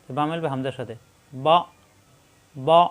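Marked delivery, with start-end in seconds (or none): 1.3-2.8 বা cut off sharply in the throat; hard stop. Shown as a demonstration. Teacher showing a hard hamza on বা. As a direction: clip বা with a throat catch. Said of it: "শক্ত করে পড়তে হবে".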